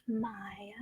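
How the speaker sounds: breathy whisper of the name. Real, not acted. Reading a fan question, whispering asker's name.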